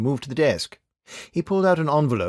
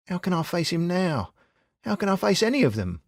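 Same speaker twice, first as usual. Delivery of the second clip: put-on higher, ashamed young man; ashamed Tim; 8 semitones higher than usual. A narrator's reading. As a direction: plead it, higher and hurt. Narrator voices Tim, ashamed.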